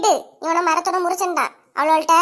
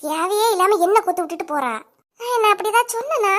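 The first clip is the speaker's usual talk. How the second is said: normal talking voice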